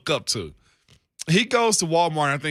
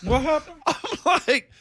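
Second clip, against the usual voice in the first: laughing; 9 semitones higher than usual. Real, not acted. Cracking up at friend's story.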